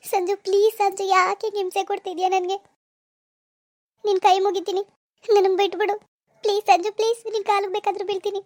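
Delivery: put-on girl begging her harasser through tears; acted weepy plea; tearful pleading. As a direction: beg to be left alone, crying. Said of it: "ಅಳ್ಬೇಡ baby".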